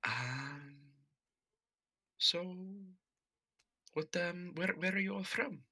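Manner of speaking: high, soft, hesitant question; awkward shyness. Acted. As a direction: venture it timidly, stumbling over the start. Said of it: "standing there awkwardly".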